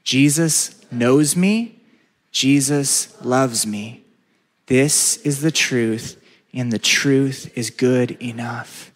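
slow, deliberate group recital; slow call and response. Shown as a demonstration. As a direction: say it slowly so everyone joins. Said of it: "Would you say that with me?".

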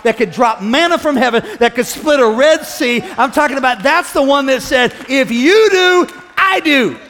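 loud, building preacher's proclamation; fired-up revival preaching; full-force preaching. Real, not acted. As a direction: drive it up to a shouted climax.

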